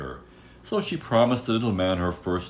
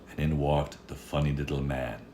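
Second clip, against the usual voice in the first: ordinary delivery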